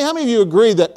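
ordinary delivery

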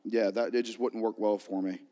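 normal talking voice

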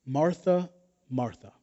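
caring, soft answer with long pauses; Jesus. Acted. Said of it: "how caring Jesus would have spoken".

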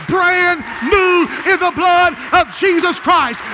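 climbing, shouted preaching, pitch rising through the run; full-force preaching. Acted. Preacher crying out to his church, full-force shouting sermon. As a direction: build the congregation up to a roar.

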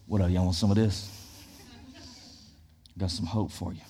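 low, quiet sidle-up like a dealer; street dealer pitch. Acted. Pastor playing a hope dealer, low furtive pitch. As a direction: offer it furtively, low and under your breath. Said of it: "Be like".

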